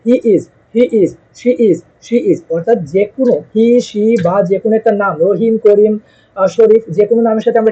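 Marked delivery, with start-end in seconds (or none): 0.1-2.4 very slow, clear drill repetition. Shown as a demonstration. Teacher to students, slowly drilling he is and she is. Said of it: "যেভাবে বলতেছি".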